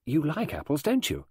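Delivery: put-on high young girl's voice; pushy schoolgirl; forward thirteen-year-old girl. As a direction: question the guest boldly, pitched high.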